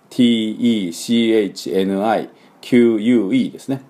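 ordinary talk

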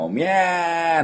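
drawn-out "おみゃー" with the English short-a sound; Nagoya-style "ya" vowel. Shown as a demonstration. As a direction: stretch "おみゃー" into a long, open "æ". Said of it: "Aはおみゃーの音ですね".

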